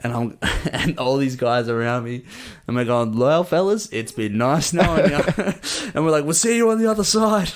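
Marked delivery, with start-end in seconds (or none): 3.2-5.2 raised-pitch comic last goodbye; mock farewell. Mates about to charge into death circle, high jokey farewell. 6.2-7.5 very high, excited comic goodbye; mock farewell. Mates bracing for the death circle, high excited farewell.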